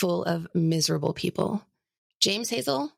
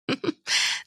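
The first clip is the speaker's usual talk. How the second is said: brief, breath-only giggle; a tiny giggle before confessing; Nanny's giggle. Acted. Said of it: "Nanny giggles again".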